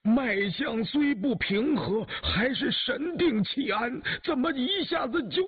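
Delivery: high, astonished outburst; shocked. A narrator's reading. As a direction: pitch it high in stunned disbelief. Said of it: "马齐惊道".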